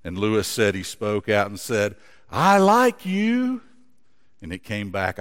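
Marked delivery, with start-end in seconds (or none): 2.3-3.6 high, slow, friendly call; boy calling kindly to the mountains. Acted. Boy calls kindly to echo.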